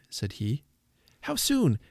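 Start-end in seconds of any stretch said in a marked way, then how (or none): 1.2-1.8 put-on very high child's question; eager cry. A narrator's reading. Little girl asking when he will return, high eager cry. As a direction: pipe up urgently, pitched high. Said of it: "cried Patty".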